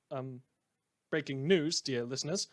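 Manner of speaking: ordinary talk, nothing marked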